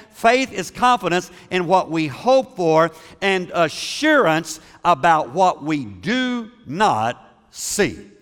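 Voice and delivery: solemn scripture reading. Acted. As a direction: read the verse out slow and solemn. Pastor reads scripture slowly.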